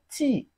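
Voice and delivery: "T" as a short, high burst of air; strong airflow. Shown as a demonstration. Teacher shows how to make the sound, airy short "T". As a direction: push air hard through a brief high "T". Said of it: "a very strong airflow".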